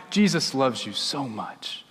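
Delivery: low, soft and slow; gentle reassurance. Shown as a demonstration. Pastor giving children the simple communion words, gently. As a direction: tell a small child tenderly, unhurried.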